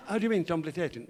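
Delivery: questioning, with comic fronted consonants; speech defect turning "complication" into t sounds; Harry. Acted. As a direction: query it through impeded diction. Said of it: "a slight speech defect".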